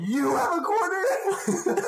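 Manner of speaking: squeaky high-pitched beggar with a laugh; homeless dude voice. Retold or imitated. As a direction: ask for a quarter in a silly high voice. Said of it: "That's the homeless dude".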